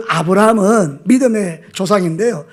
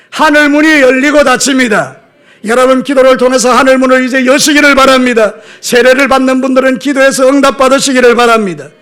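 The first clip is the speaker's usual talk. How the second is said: loud, raised, sustained pulpit exhortation; full-force preaching; 4 semitones higher, 6 dB louder than usual. Real, not acted. Pastor urges congregation to open heaven by prayer, forceful preaching. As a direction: proclaim it forcefully to the whole congregation.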